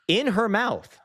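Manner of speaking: high, drawn-out disbelief; appalled emphasis. Real, not acted. Streamer telling viewers about his puppy's mess, appalled. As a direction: pitch it way up in horror. Appalled climax of gross dog story.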